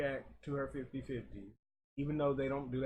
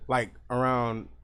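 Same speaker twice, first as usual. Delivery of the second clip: loud, right up on the mic; close to the mic; 9 dB louder than usual. Shown as a demonstration. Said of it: "if I get close".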